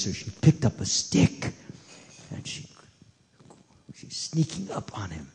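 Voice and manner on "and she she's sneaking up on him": dropped low and much softer, as if creeping up; hushed sneaking narration. A narrator's reading. Storyteller to children, mother sneaking up: hushed and soft. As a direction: hush it like you're tiptoeing up behind him.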